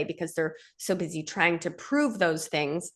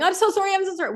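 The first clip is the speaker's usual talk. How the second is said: much higher, pleading repeat; frantic apology; 12 semitones higher, faster than usual. Pretend. Man in a trauma response apologizes to wife, high. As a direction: grovel in panic. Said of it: "or you start going".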